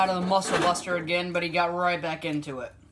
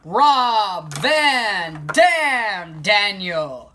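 slow, drawn-out, louder announcer call; ring announcer intro; 5 dB louder, much slower than usual. Pretend. Gamer introducing the next wrestler, drawn-out announcer voice. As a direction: stretch each name like a ring announcer.

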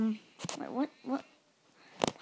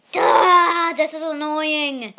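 loud, higher, exasperated duh; annoyed shout; 4 semitones higher, 10 dB louder, slower than usual. Real, not acted. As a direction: blurt it out at the screen. Annoyed at the game.

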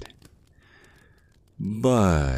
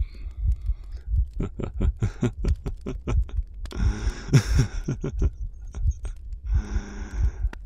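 long, airy villainous laugh under the breath; a drawn-out whispered gloating laugh; whispered evil giggle; 7 semitones higher than usual. Acted. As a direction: savour the win with a hushed cackle. Vampire gloating over victim.

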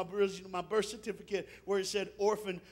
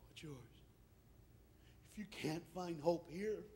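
soft, hushed preaching with long pauses; gentle pastoral appeal; 2 semitones lower, 14 dB quieter than usual. Real, not acted. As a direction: speak quietly and tenderly to the hurting.

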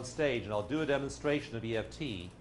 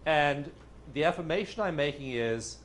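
usual speaking voice

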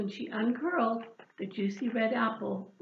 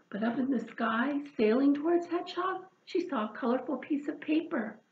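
usual speaking voice

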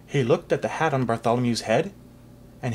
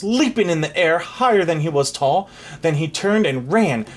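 ordinary delivery

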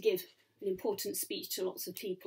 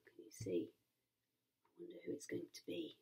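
very soft murmured wondering; hushed suspense; 14 semitones lower, 15 dB quieter, faster than usual. Real, not acted. Suspenseful aside to child.

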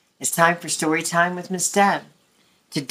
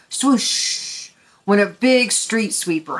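ordinary delivery, nothing marked

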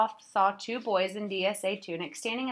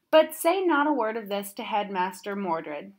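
usual speaking voice

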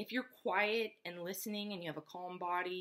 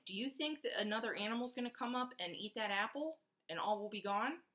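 usual speaking voice

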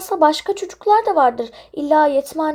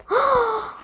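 delighted gasp; 6 semitones higher than usual. Acted. Kids find favourite study room.

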